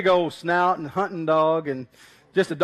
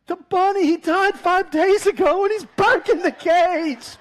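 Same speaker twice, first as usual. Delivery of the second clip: much higher, louder and slow, aghast; horrified neighbor; 10 semitones higher, 7 dB louder than usual. Retold or imitated. Neighbor explains the buried bunny is in its cage, aghast. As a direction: spell out the horror slowly. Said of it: "He said".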